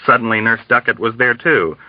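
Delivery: usual speaking voice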